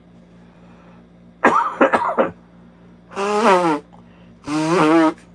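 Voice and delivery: harsh coughing fit; coughing after swallowing wrong; choking cough. Real, not acted. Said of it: "That went down the wrong pipe".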